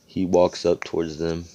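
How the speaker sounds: usual speaking voice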